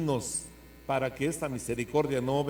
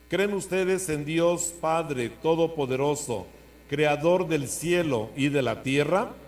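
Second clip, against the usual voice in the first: liturgical proclamation; 2 semitones higher, 3 dB louder than usual. Acted. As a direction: proclaim each question solemnly. Priest leads creed questions.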